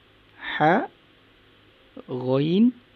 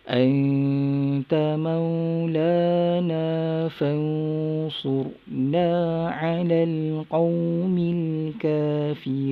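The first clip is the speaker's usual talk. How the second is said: Quran recitation. Shown as a demonstration. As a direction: lead the class through the verse in recitation.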